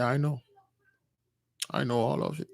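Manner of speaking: soft, low acted confession; quiet admission. Moses admitting to his friend he knows, quiet admission. Retold grave admission of a secret.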